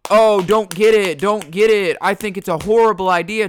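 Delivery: loud mock nagging voice; mocking preachy anti-vaxxer. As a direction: lecture everyone in a hammed-up scold. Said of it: "but not be like".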